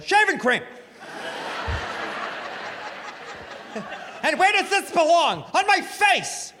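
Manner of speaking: husband showing off on wife's quiz; cocky quiz contestant. Retold or imitated. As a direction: answer like you're on a hot streak. Cocky pop-quiz answers.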